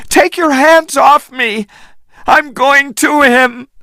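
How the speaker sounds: very high angry shout. A narrator's reading. Anne pulling away from Jack, angry shout. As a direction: fight free of his grip. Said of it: "she shouted angrily".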